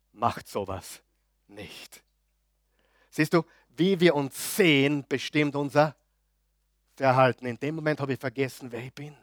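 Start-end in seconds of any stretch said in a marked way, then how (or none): none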